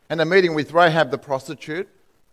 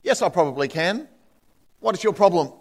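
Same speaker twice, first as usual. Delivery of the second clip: ordinary talk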